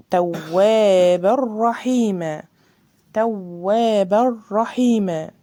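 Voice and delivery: توابا رحيما slow, tanween merged into a doubled ra; tajweed reading; slow recitation. Shown as a demonstration. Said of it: "الراء برضو مشددة".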